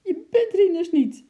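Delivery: very high, loud shriek of alarm. A narrator's reading. Wife discovers the chimp is not Rinus, shrieking. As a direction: shriek it out in shock. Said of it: "gilde ze tegen Sjimp".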